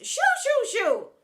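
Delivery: very loud, high shoo. Acted. Headmistress shooing the teacher away, very loud. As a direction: shoo her off.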